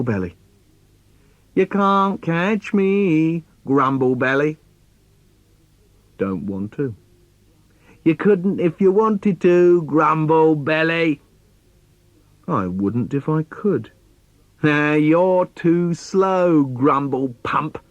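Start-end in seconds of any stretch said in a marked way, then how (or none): 1.5-4.6 higher, slow sing-song taunt; little boy dares his dad to chase him; teasing little boy. Retold or imitated. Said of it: "my little boy trying to get me to play". 8.0-11.2 put-on little boy dares his dad to chase him; teasing little boy. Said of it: "my little boy trying to get me to play". 14.6-17.8 little boy mocks his slow dad; cheeky boy taunt. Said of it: "my little boy trying to get me to play".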